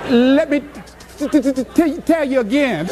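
stammering t-t-t through laughter; stuttering friend. Retold or imitated. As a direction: laugh while stuttering out tell. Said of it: "she said".

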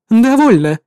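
loud authoritative halt; commanding. Acted. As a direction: stop them with authority. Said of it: "заявила она властным тоном".

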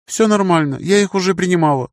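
put-on a touch of a child's high voice; little girl. A narrator's reading.

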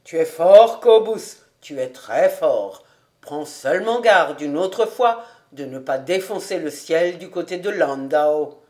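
loud hearty teasing cry; loud jolly ribbing of Kobus; joyful exclaim. A narrator's reading. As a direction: slap his shoulder and crow with joy. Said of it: "s'écria tout joyeux".

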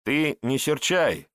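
ordinary delivery

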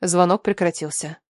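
usual speaking voice

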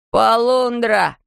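high, drawn-out alarm call; shouted alarm. A narrator's reading. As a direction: call it out slowly like a warning cry. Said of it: "крикнул Джонатан".

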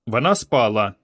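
ordinary delivery, nothing marked

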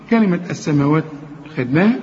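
normal talking voice

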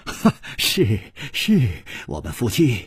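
wild defiance climbing into a laugh; reckless, rising outburst ending in desperate laughter; roaring. A narrator's reading. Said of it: "大吼着说".

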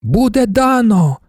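high, drawn-out crowd cry; hall's response. A narrator's reading. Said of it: "Уся зала завібрувала від відповіді".

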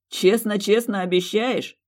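slow, teasing and mocking; mocking drawl. Fiancé mocking her childish promise, slow and teasing. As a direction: stretch it out in a sneer. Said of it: "издевательски протянул мужчина".

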